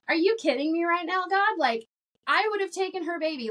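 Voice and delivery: half-crying, high-pitched demand as she relives her fury at God; angry at God. Retold or imitated. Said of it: "turned to God even more angry".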